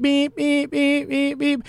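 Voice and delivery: high, even, repeated alarm beeps; imitated alarm. Storyteller mimicking the alarm that interrupted a date, high monotone beeping. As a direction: mimic a phone alarm on one high note. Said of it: "she heard this alarm going off".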